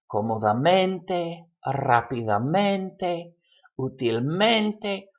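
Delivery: slow "cómodamente, rápidamente, útilmente", each landing on MEN; stress on mente. Shown as a demonstration. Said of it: "the stress goes on the mente".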